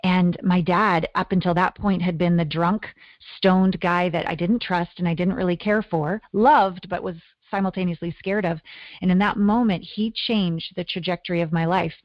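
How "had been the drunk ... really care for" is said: normal talking voice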